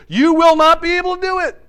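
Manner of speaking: booming warning. Acted. Joshua warning the people that they cannot serve the Lord, booming and high. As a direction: hurl it at them like a stern prophet.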